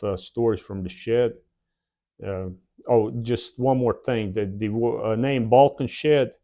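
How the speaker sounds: normal talking voice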